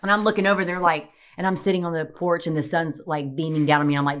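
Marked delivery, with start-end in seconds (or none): none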